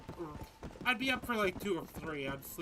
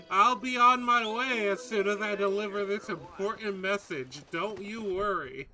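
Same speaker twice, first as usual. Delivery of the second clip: put-on louder, gee-whiz eager kid voice; wholesome 1950s kid; 3 semitones higher, 7 dB louder than usual. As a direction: parody of a squeaky-clean farm boy.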